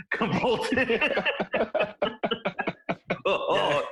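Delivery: high laughter through the word; cracking up while describing it; laughing hard. Real, not acted. Mocks friend foaming at mouth.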